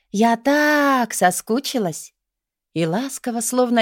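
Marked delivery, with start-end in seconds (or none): 0.1-2.1 higher, long drawn-out gush. A narrator's reading. Girlfriend tells the magister she missed him, gushing. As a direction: stretch the middle word with longing.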